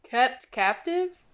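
patch the sentence from different voices; mismatched voices. Acted. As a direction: creature voice switching pitch mid-sentence. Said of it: "mismatch of voices throughout the sentence".

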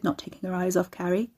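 ordinary delivery, nothing marked

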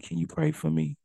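soft, small plea for prayer; pleading request. Retold or imitated. Humbled people asking the preacher to pray, softly. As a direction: ask quietly, humbled. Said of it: "people start saying".

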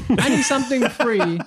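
giggling as he demands something free; laughing. Acted. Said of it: "laughing demand".

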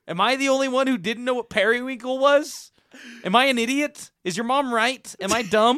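high and loud, frustrated; exasperated yelling. Real, not acted. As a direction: rant in disbelief. Exasperated argument about periwinkle.

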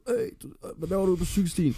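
high-pitched, squeaky put-on cyclist voice; angry cyclist. Cyclist confronts someone who stepped onto the bike lane, high mock protest. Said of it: "Der kommer en fyr tonsende".

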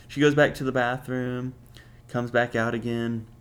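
ordinary talk

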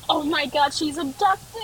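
high, half-crying mock panic. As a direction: act out the frantic fear of losing a friend. Retold panic over missing friend.

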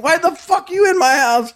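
very high, strained mock shout; retold screaming. Homeowner demands why stranger is in his house, mock screaming. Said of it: "I'm screaming at this dude".

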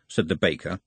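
ordinary delivery

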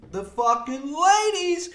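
a hint of Bill Burr, high and slow; lightly quoting a comedian's catchphrase; Bill Burr impression. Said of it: "I love Bill Burr's saying of".

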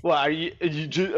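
ordinary talk

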